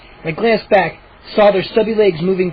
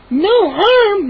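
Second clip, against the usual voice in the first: very high shrieked question; 12 semitones higher than usual. Acted. Mindy shrieking at the gnomes. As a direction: shriek in disbelief. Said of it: "Mindy shrieked".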